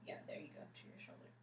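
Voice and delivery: soft whispered guidance; whispering. Real, not acted. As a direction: whisper encouragement to the patient. Guiding patient's head position.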